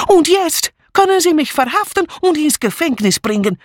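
put-on high, agitated foreign-accented challenge; dramatic defiance. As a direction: dare him to arrest you. Maid dares inspector to arrest her.

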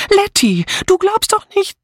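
aghast, very high-pitched; shocked high appeal; horrified. A narrator's reading. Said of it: "rief Dora entsetzt".

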